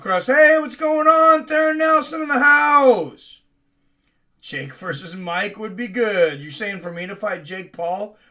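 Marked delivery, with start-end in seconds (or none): 0.3-3.4 loud, high hype greeting; hype shout. Acted. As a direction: shout it like an announcer.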